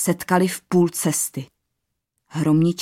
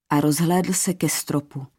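ordinary delivery, nothing marked

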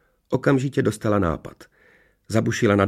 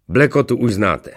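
slightly raised, louder, drawn-out introduction; pompous sorcerer; 3 semitones higher, 7 dB louder than usual. A narrator's reading. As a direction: present your servant like a lordly master. Rumburak představuje svůj personál. (povýšený čaroděj představuje služebnictvo)

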